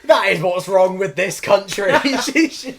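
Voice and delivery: high, loud, slow hammed-up indignation; mock outrage. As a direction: rail like a scandalised auntie. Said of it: "was like".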